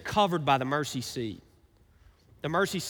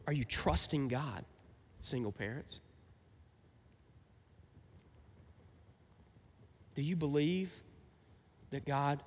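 hushed, weighty asking, phrase by phrase; gentle earnest appeal; 3 semitones lower, 8 dB quieter than usual. Real, not acted. Pastor asking single parents about trust, gently and earnestly.

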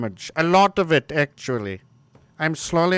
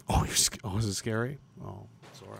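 sarcastic hushed apology; fake-sorry near whisper; mock apology; 7 semitones lower, 14 dB quieter, faster than usual. Streamer sarcastically apologizing to the scared.

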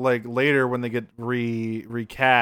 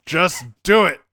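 louder, higher curt order through laughter; laughing command; 5 semitones higher, 5 dB louder than usual. Retold or imitated. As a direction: snap the order while cracking up. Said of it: "this is how I feel this went".

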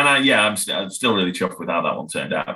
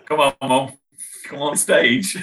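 higher, coaxing mock call-out; playful raised-pitch summons; calling someone over; 6 semitones higher, slower than usual. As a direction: pretend to beckon your mum up. Imagines calling mum on stage.

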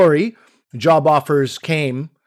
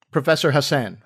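ordinary delivery

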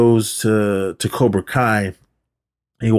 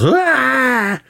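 mock scream. Man flying back through doors.

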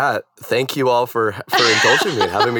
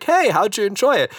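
retold chirpy question; 11 semitones higher than usual. Retold cheerful question after performing.

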